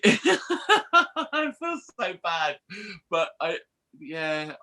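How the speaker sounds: laughing through the words, high-pitched; real, helpless giggling; guilty laughter. Real, not acted. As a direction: try to apologise while cracking up. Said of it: "I shouldn't laugh".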